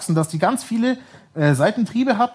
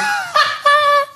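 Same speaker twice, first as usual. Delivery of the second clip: very high burst of laughter; real laughter; 4 dB louder than usual. Real, not acted. Friends amused at father's claim to have enabled it, bursting out laughing. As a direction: burst out laughing mid-sentence.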